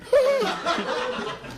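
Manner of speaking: imitated singer gasp. Telling his cohost to hear the breathing, sharp high gasp. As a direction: gasp like a singer pulling off the mic. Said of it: "listen to him breathing".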